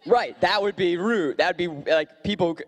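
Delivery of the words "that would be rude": ordinary talk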